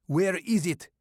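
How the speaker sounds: put-on loud, high Russian-accented demand. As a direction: interrogate in a heavy Russian accent. Russian attacker interrogating Maddox.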